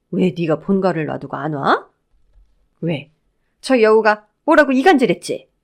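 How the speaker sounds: mother-in-law's suspicious, rising outburst; jealous, cross interrogation; indignant accusation. A narrator's reading. Jealous mother-in-law snaps at son.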